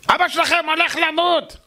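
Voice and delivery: high, harsh, blurting doctor; blunt shouted news. Retold or imitated. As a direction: announce the death bluntly, up high. (רופא צועק בבוטות, בקול גבוה)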